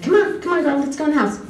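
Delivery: high-pitched call to a pet; coaxing a dog. Retold or imitated. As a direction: imitate calling the dog in. Calling the dog inside.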